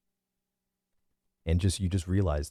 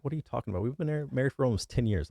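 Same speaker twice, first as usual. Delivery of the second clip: higher-pitched, incredulous retort to a partner; exasperated protest; 7 semitones higher, much faster than usual. Retold or imitated. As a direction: protest in disbelief, pitched well up. Retold protest in an argument with wife.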